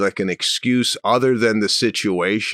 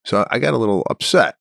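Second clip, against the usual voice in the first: usual speaking voice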